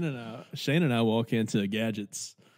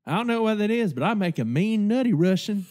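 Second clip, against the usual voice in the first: lightly voiced bartender, pitched up; bartender impression; 6 semitones higher than usual. Bartender answering a drink order, lightly mimicked in raised pitch. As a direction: play the confident bartender, a bit higher.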